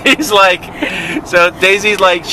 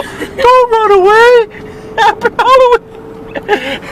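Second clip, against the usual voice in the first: very high, slow comic wail; mock pleading call; 4 dB louder, slower than usual. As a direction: call after them in a hammed-up squeal. Friend chasing people, calling out.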